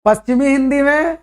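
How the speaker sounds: each dialect name drawn out slowly with long pauses; slow dictation. Acted. Teacher slowly dictating Hindi dialect groups to students. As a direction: spell it out slowly so students can write it down.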